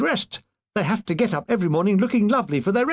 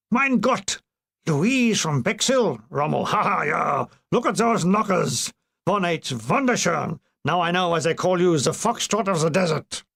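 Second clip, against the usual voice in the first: hammed-up German general accent; gloating German officer accent, slowed. A German general ogling a photo, German accent. Rommel voiced in comic scene.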